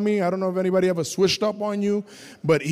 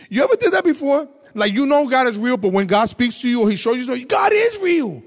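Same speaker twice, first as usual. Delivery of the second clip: raised, loud, driving preacher voice; loud fervent preaching; 6 semitones higher, 5 dB louder than usual. Real, not acted. Preacher urging the men, loud and high. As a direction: preach it to the room. Testimony climax, shouting.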